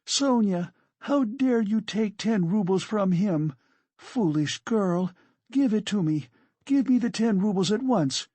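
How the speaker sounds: high, urgent angry demand; indignant scolding. Acted. Katerina scolds and demands money.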